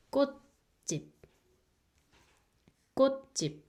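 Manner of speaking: teacher models "꽃집" for learners; Korean word kkotjip. Shown as a demonstration. Teacher sounding out "꽃집" for students. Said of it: "it's like".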